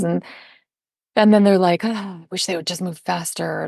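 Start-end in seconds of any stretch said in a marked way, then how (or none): 1.8-3.7 soft, sighing mock grumble; grumbling. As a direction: grumble quietly about the hold-up. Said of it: "they start grumbling".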